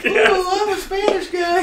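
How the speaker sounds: high, loud hammed-up Spanish guy voice; over-the-top Spanish accent shout; mock Spanish accent. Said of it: "do one of my jokes".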